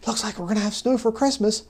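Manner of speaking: high-pitched imitation of an excited woman; excited older woman. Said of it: "she was just so excited".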